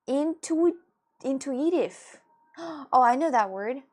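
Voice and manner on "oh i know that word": ordinary talk, nothing marked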